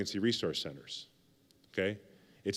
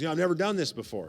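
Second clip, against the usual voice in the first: imitating an eager first-timer, higher and fast; happy and eager; 6 semitones higher, faster than usual. Newcomer tells the speaker he has never prayed there, eager and fast. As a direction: rush it out with keen excitement. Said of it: "He was all happy".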